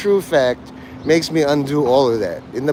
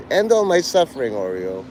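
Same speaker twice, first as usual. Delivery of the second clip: usual speaking voice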